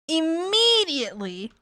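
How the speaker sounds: ordinary talk, nothing marked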